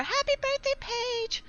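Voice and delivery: high singsong mock-sweet call; fake cheery greeting. Student greeting Paige the next time, fake cheery. Repeats the fake polite taunt.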